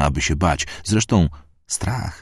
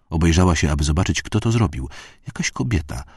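usual speaking voice

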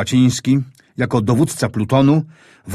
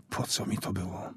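a breathless whisper of dread; frightened soldier whispering to himself under fire; whispered thought; 4 semitones lower, 17 dB quieter than usual. Acted. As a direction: hush it as a frightened inner voice.

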